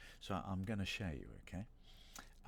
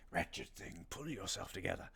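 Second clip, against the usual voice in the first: character whisper. Pretend. Streamer retrying the cameo line for chat, whispered. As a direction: breathe it like a sinister voice in the head.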